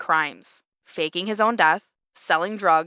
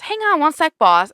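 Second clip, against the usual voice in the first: higher, louder hurried call to the boss; Creed quote; 9 semitones higher, 7 dB louder than usual. Retold or imitated. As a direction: toss it off quickly while already leaving. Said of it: "And Creed goes".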